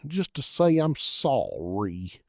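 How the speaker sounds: mock falsetto crooning. A narrator's reading. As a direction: stretch out the sorry like a bad ballad. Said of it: "dreadful little Anthony falsetto".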